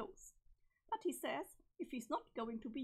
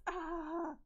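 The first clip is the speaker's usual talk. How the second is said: short startled scream; 4 semitones higher than usual. Acted. Character screams.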